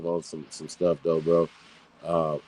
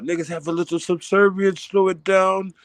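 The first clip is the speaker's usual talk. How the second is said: higher-pitched mock quote of the fools; 10 semitones higher than usual. Reactor quoting the video's fools to his guest, mocking quote. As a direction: lightly mimic them in a raised pitch. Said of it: "these fools talking about".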